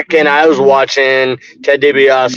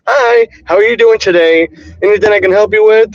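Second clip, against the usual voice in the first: higher-pitched customer-service voice; work voice; 5 semitones higher than usual. Shown as a demonstration. Coworker greeting customers while trying to be nice, put-on work voice. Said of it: "I put on my, you know, my work voice".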